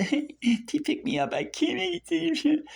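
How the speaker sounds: high, slow whiny mimicry; mock a whining complainer in a high voice; mock whine. Mocking people who whine they can't make it. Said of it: "it sounds like I'm mocking".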